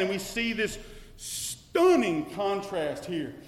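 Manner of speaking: loud, rising sermon climax; full-voiced fervent preaching; preaching at full force. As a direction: drive the truth home to the congregation.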